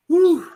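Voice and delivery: sharp high exhale of relief; high shaky whew. Real, not acted. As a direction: blow out a loud high whew. Reacts after confessing fear.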